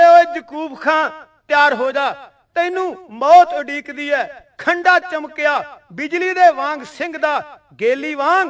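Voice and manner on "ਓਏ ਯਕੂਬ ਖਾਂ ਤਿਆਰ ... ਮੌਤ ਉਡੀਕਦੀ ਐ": usual speaking voice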